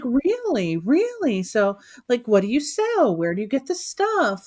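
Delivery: high-pitched, gushing curiosity; imitated keen interest. Bank manager quizzing customer about the business, gushing interest. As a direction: mimic someone eagerly firing off questions. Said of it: "very interested in the whole thing".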